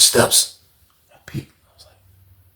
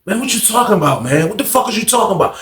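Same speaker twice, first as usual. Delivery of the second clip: retold snapping; 6 semitones higher than usual.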